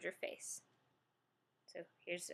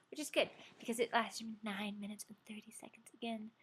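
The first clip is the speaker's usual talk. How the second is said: whispered aside; whispering. Real, not acted. Whispered aside about time.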